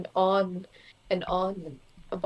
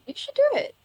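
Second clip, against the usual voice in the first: excited encouragement; 5 dB louder, faster than usual. Real, not acted. Encouraging friend's busking plan.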